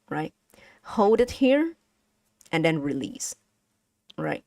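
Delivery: usual speaking voice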